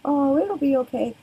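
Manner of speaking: high, sing-song comforting coo; cooing to a hurt little girl. Retold or imitated. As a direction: soothe a crying toddler, sweetie-style. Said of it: "will go".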